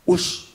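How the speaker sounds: hissed swoosh of a missed swing; voiceless racket whoosh; imitated whoosh. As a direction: make a quick swishing noise of a racket through air. Mimes the racket missing the ball.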